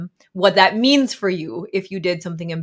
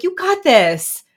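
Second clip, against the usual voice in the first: high, cheery self-encouragement; cheesy pep talk; 8 semitones higher, 7 dB louder than usual. Shown as a demonstration. Mom cheering herself on, high and bright. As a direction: cheer yourself on like a coach. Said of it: "I'm a little cheesy in my self-talk".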